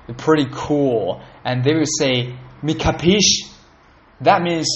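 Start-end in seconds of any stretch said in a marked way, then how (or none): 2.6-3.5 tough Italian-American gangster line; gangster Italian-English. Retold or imitated. Mock mobster asking a guy if he gets it. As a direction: say it like a New York mobster. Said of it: "like, Italian English".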